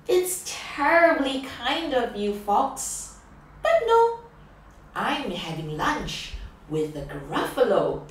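mouse voice, jumping high on no; a polite, cheeky little mouse; bright little mouse. Acted. Mouse politely turning down the fox, bright and bold.

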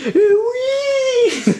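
a huge high squeal of joy, laughing; shrieking laughter of pure joy; ecstatic shriek. Real, not acted. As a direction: scream with delight as the card lands. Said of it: "vous allez m'entendre jouir".

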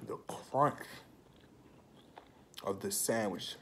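fingers at the lips; tasting food while speaking; talking while chewing. Real, not acted. Seen 1: Fingertips at lips through 2.0s, mouth working; hand away 2.2-2.5.

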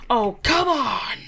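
Sif's fed-up catchphrase, drawn out slow; exasperated catchphrase. Pretend. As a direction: drag out the complaint, x0.5. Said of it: "every episode is her being like".

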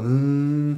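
soft, lazy "mm" in an odd voice; drunken hum. Retold or imitated. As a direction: hum it half-hearted at the scolding wife. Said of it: "le hago". ("mm" suave de borracho)

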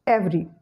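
"every" as two syllables, EV-ree; schwa after V deleted. Shown as a demonstration. Teacher showing the correct form, "every" said as EV-ree. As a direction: run V straight into R in "every". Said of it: "you will pronounce".

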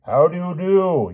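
high, drawn-out hearty yell. A narrator's reading. Sir Grummore greets the princess, yelling. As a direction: call a greeting across a distance. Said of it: "yelled Sir Grummore".

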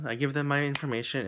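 ordinary delivery

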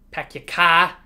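normal talking voice

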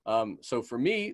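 ordinary talk, nothing marked

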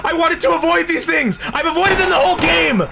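high, distressed complaint; panicked wail. Real, not acted. Player cornered by things he avoided, high panicked wail. Player wails under monster attack.

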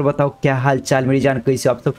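ordinary delivery

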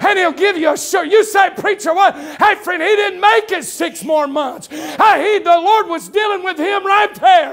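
high, driving, shouted sermon delivery; full-force preaching. Real, not acted. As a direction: preach it hard, punching phrase after phrase.

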